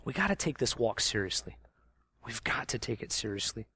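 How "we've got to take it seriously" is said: whispered, hushed plea; urgent whisper. Acted. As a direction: lean in and whisper it. Repeats line as hushed plea.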